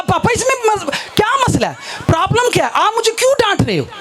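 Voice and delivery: high, exasperated daughter talking back; acted indignant protest. Scolded daughter demanding why papa scolds her, high indignant voice. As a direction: push back at papa, voice pitched well up.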